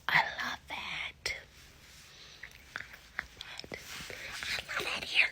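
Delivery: gushing whisper, breath only, no voice. Real, not acted. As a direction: whisper it like a secret delight. Gushing over the engagement ring.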